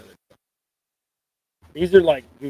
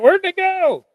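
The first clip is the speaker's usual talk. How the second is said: surprised; 5 dB louder, slower than usual. Retold or imitated. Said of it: "I was like".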